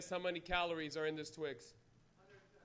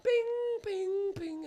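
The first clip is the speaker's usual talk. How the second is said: high sung chime, stepping down; three ringing notes, falling; subway door chime; 11 semitones higher, 3 dB louder, much slower than usual. Retold or imitated. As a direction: imitate descending train chimes. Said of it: "'cause it goes".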